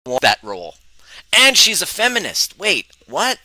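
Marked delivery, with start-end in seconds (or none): 1.3-2.6 much higher, louder mock disbelief; mock amazement. Real, not acted. Telling viewers the punchline, high mock amazement. 2.6-3.4 higher, rising disbelief; mock double take. Real, not acted. Joking double take for viewers, rising.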